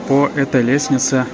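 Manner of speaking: ordinary talk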